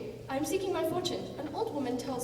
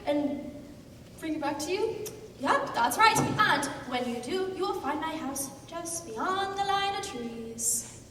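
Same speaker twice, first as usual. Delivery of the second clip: ordinary talk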